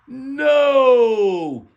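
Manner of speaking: long, high, loud drawn-out "No!"; stadium yell. Acted. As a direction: yell it like a whole crowd at a ballpark. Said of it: "entire stadium was yelling".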